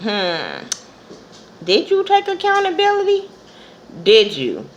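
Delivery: a hint of a skeptical viewer calling her out; sarcastic challenge. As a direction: needle her with a pointed question. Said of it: "I'm like".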